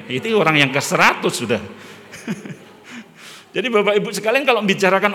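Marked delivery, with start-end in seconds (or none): 1.7-2.5 soft airless chuckle; breathy laugh. Real, not acted. Preacher laughing softly at the congregation after the gossip joke. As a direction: laugh quietly at your own joke.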